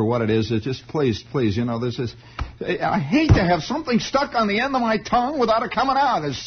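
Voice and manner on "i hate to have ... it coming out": high, exasperated plea. Real, not acted. Begging the audience for the forgotten phrase, high. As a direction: whine in mock frustration.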